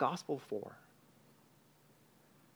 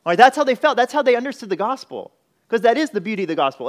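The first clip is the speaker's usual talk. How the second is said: near shout, much higher and louder, fast on the last line; loud preaching; 8 semitones higher, 10 dB louder, faster than usual. Real, not acted. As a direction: preach it at full force.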